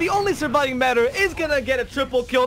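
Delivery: high, loud excited casting; live play-by-play. Acted. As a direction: call every fight as it happens. Live Dota match casting.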